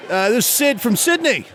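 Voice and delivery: put-on high-pitched Australian accent; second Australian caller. Sid phones the radio contest, high Australian accent. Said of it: "two completely separate accents".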